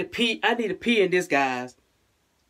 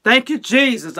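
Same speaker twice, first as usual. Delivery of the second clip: louder, higher burst of thanks; real relief pouring out; relieved thanks; 5 semitones higher, 5 dB louder than usual. Real, not acted. Mother thanking God her test is negative, relieved louder thanks.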